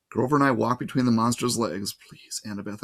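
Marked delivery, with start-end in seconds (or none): 1.9-2.8 much softer, hushed plea; whispered prayer. A narrator's reading. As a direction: breathe the plea almost silently. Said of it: "I prayed".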